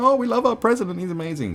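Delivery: high, loud fawning fan parody; hammed-up worship; mock adoration. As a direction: gush over a leader, sending it up. Mocking adoring supporters.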